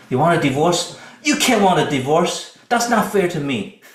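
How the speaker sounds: loud, high, indignant protest; fighting it. Shown as a demonstration. Spouse fighting news of a divorce, indignant. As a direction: argue back heatedly, refusing to accept. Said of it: "You're fighting it".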